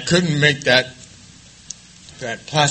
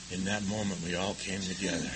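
hushed; 6 semitones lower, 17 dB quieter than usual. Real, not acted. Hushed reverent repetition.

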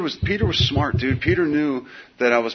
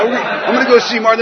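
high, louder laughing cop voice; mock cop chatter; 12 semitones higher, 9 dB louder than usual. Cops chatting about him, mimicked high and loud. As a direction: mimic the cops, much higher.

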